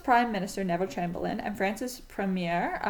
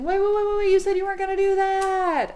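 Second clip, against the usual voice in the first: much higher and louder mock panic; alarmed protest; 10 semitones higher, 8 dB louder than usual. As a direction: cry out that a promise was broken. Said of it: "the British and the French are saying".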